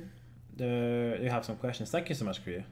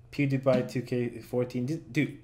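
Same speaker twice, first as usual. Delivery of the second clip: usual speaking voice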